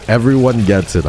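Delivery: emphatic declaration. Real, not acted. Louder repeat of phrase.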